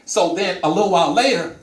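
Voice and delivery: ordinary talk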